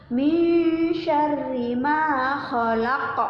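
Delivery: slow, drawn-out tajwid chant; Quran recitation. Shown as a demonstration. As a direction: stretch each syllable in recitation. Recites verse two.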